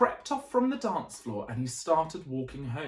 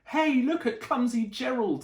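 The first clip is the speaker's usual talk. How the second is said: teasing jeer, higher and louder; mocking animals; 7 semitones higher, 5 dB louder than usual. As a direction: taunt him like a playground bully. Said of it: "the animals all laughed".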